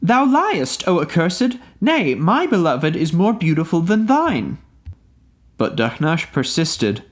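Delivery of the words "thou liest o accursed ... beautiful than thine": higher, indignant fairy queen's retort; crying out in anger. A narrator's reading. Maimuna denouncing Dahnash as a liar, an indignant, higher cry. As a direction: fling the accusation at him in a raised voice. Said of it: "Cried Maimuna".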